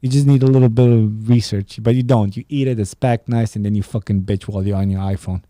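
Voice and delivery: ordinary delivery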